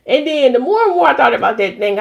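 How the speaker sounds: ordinary delivery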